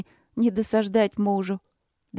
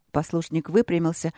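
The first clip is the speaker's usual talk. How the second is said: usual speaking voice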